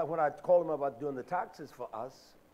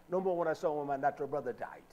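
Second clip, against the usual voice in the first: normal talking voice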